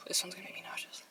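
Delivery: very soft, breath-only dread; queasy whisper. Real, not acted. Vlogger about to bite the blue cookie, queasy whisper. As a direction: whisper it under a sigh.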